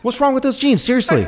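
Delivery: squeaky put-on movie voice; high mock movie voice. Friend quoting a movie scene, high whiny voice.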